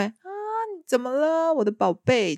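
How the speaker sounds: high, sugary coo to a grown son; doting mother-in-law. Retold or imitated. Mother-in-law cooing at her grown son, high and doting. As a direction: imitate a mother fussing over her baby. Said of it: "就是婆婆这样说".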